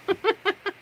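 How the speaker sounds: quick high-pitched giggle; little embarrassed laugh; short high laugh. Real, not acted. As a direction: break into a brief giggle. Laughing at own rant.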